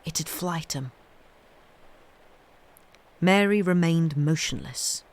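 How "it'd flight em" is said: hushed warning, much softer; low, quiet voice. A narrator's reading. Boy warning Mary not to scare the animals, hushed. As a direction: breathe it low so nothing startles. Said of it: "in a voice almost as low".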